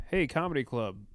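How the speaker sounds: normal talking voice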